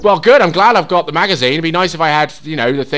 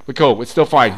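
usual speaking voice

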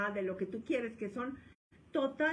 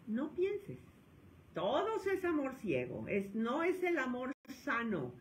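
normal talking voice

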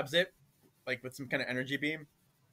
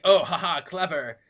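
sarcastic villain; 4 semitones higher, 12 dB louder than usual. Retold or imitated. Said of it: "He's like".

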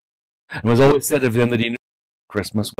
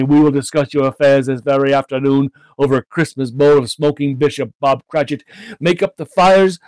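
raised, exuberant, heartfelt promise; hearty earnestness; 5 semitones higher, 5 dB louder than usual. A narrator's reading. Scrooge promises to help his clerk's family, hearty earnestness. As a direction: pour out generous promises with rising zeal. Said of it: "said Scrooge with an earnestness that could not be mistaken".

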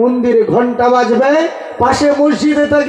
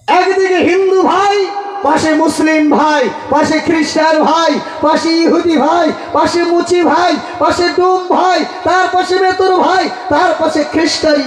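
fiery preaching; 6 semitones higher than usual. Acted. Preacher calls out the list.

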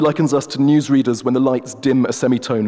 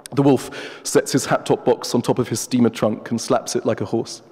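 ordinary delivery, nothing marked

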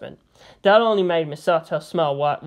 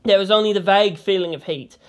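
ordinary delivery

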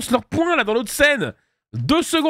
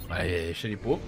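lower, softer repeat to himself; softer, lower throwaway; quiet aside; 12 semitones lower, 9 dB quieter than usual. Real, not acted. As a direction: drop it under your breath as a joke.

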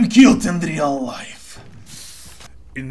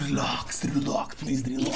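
whispering; 7 semitones lower, 16 dB quieter, faster than usual. Real, not acted. Calming himself in a clutch.

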